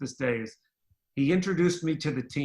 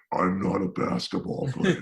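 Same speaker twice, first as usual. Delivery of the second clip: lower, slow menacing voice; lower, slow mock evil voice; 6 semitones lower than usual. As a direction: growl it like a villain. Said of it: "almost like this evil".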